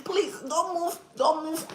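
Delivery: high, loud imitation of begging; mock pleading. Streamer mimicking someone in bed begging, high mock plea.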